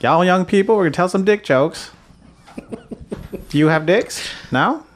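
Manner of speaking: put-on old-time street barker voice; 1940s newsreel voice. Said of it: "you automatically do nineteen forties".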